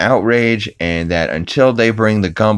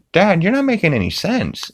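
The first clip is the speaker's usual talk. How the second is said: Chris voice; 12 semitones higher than usual. Retold or imitated. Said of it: "Chris is like".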